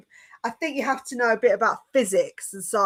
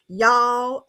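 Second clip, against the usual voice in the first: lightly imitated Texas "Y'all"; Texas accent; 3 semitones higher, 3 dB louder than usual. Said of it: "the American Texas accent".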